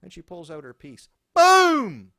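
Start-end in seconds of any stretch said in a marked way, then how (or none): 1.3-2.1 gunshot boom. Retold or imitated. Gunshot imitated.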